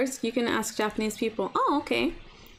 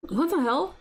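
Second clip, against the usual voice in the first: usual speaking voice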